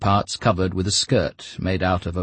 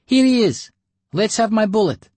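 put-on high, loud child's voice; demanding little girl; 7 dB louder than usual. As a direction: demand your bullet like a greedy kid.

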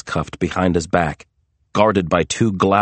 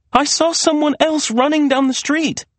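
urgent insistence. A narrator's reading. Maria insists she was chased.

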